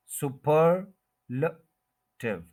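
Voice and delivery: Superlative very slowly as su-PER... luh... tiv; superlative drawn out, short luh on its own; syllable by syllable. Shown as a demonstration. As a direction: break superlative apart with pauses. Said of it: "second syllable पे आपने stress".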